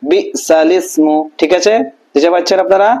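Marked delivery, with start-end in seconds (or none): 0.1-1.2 বিসালিসমু joined into one word, very slow; drawn-out blended recitation of বিসালিসমু; Quranic reading demo. Shown as a demonstration. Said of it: "এখানে কি দেখেন". (ধীরে টেনে বিসালিসমু একসাথে)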